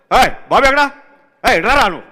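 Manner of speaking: loud, high call across the set; mock director yelling for his man; imitating director calling out. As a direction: summon the assistant at full voice. Director calling assistant, retold.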